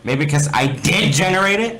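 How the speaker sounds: high, loud sarcastic reply; sarcastic retort. Acted. Alex mocking Steve in the argument, sarcastic. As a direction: throw it back mockingly. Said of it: "Alex argues back".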